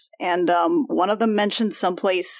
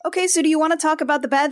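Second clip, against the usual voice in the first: ordinary talk, nothing marked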